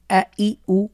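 আ, ই, উ said short and quick with no vowel stretch; quick drill without stretching. Shown as a demonstration. Teacher showing students quick unstretched reading of আ, ই, উ. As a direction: snap through আ, ই, উ without drawing out any vowel. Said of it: "এই যে আমি টান ছাড়া দ্রুত পড়ছি".

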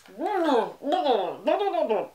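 high wailing laugh in falling waves. Real, not acted. Reacts to painful detangling.

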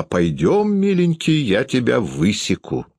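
high, swooping call; narrator's acted threatening mother; mother shouting. A narrator's reading. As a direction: lift sharply in pitch, then come down. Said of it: "кричала она".

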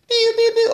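high, loud blaster noises; laser pew sounds. Retold or imitated. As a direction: zap like a toy laser gun. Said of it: "or those sounds".

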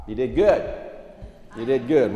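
ordinary talk, nothing marked

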